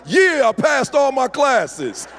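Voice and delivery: high, loud, drawn-out hollering; hammed-up victory yell; triumphant son. Son announcing he passed all his classes, triumphant.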